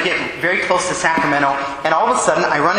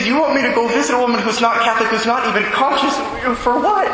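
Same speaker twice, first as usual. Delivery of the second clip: incredulous; 7 semitones higher than usual. Retold or imitated. Exasperated retort he didn't say.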